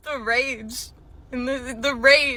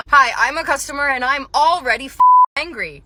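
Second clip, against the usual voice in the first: grumpy customer; 4 semitones higher, 7 dB louder than usual. Retold or imitated. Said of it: "grumpy face and everything".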